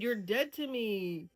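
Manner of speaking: normal talking voice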